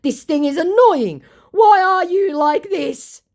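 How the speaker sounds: mock his exasperation; hammed-up pleading scammer; whiny scammer voice. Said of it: "he goes".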